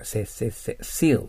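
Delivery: せ repeated in short beats, leading into seal with a pure s; S drill. Shown as a demonstration. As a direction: drill せ three times, then land seal on a falling tone. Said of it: "背の口をしてすと言ってください".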